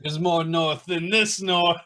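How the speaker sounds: high-pitched hammed-up Yorkshire echo; mock Yorkshire accent. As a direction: repeat it as a cartoon northerner. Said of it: "different Yorkshire accents".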